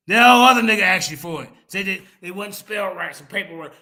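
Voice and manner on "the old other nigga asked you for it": much louder, insistent; heated, forceful repeat; loud accusation. Real, not acted. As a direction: raise your voice to drive it home.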